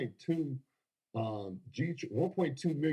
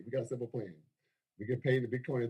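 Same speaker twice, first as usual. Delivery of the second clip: usual speaking voice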